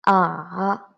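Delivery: AH dipping, then rising; third tone. Shown as a demonstration. Teacher drilling first line, ah dipping. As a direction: take AH low and back up. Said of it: "now let's have a try".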